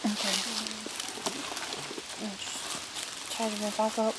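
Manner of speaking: usual speaking voice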